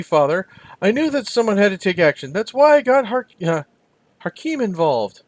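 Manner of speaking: high, loud, trembling insistence; a terrified daughter refusing comfort; frightened young woman. Acted. Daughter insisting to the rescuer, high and loud.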